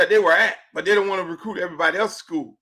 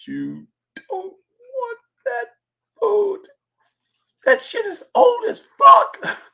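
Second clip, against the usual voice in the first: drawn-out, high mock-pained warning; exaggerated high, slow caution; 5 dB louder, slower than usual. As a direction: stretch it out high, as if appalled by the food. Mocking warning about airline food.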